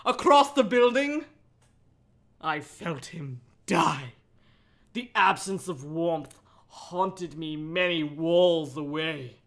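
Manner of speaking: low, slow, mock-spooky read; hammed-up grave narration; ominous dramatic reading. Shown as a demonstration. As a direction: read it like a horror trailer. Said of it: "listen to this next sentence. Ready?".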